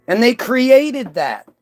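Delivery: normal talking voice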